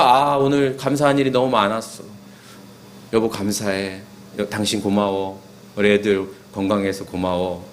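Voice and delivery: low, softened intimate murmur; quietly acting out heartfelt gratitude; tender thanks. Spouse winding down the day, tenderly thanking the family.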